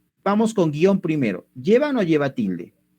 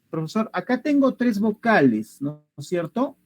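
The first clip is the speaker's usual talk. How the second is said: a hint of a student's higher-pitched voice; student role, pitched up a little; lightly voiced student; 7 semitones higher than usual. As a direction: lightly play a pupil checking with the teacher. Teacher voices a student addressing him.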